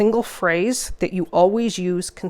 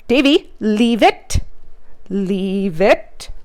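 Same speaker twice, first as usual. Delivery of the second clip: firm command; much slower than usual. Shown as a demonstration. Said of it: "So I'll put that down".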